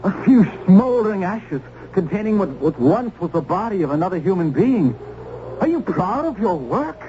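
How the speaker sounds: high, accusing and indignant; bitter accusation. Acted. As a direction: throw it at him in disgust. Accusing Mather over burned woman.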